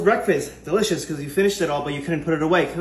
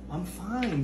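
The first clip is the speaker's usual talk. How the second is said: ordinary talk, nothing marked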